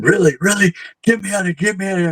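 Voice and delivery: high, frantic pleading in mock panic; imitating panicked man. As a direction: squeak it out like a scared man begging to leave. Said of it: "he was in a panic".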